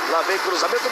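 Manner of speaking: ordinary talk, nothing marked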